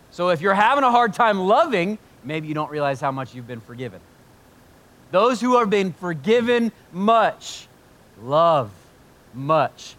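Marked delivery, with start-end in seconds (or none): 0.1-2.0 emphatic preaching. Acted. Preacher pressing the point home. 5.1-9.9 slow and loud, "love" and "much" set apart; emphatic scripture quote. Acted. Preacher repeating Jesus' words to the church, emphatic.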